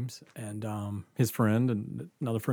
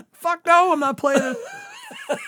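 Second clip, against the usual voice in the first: very high, loud protest; panicked retold shout; 8 dB louder, slower than usual. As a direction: yell the refusal in hammed-up horror. Retold horrified refusal.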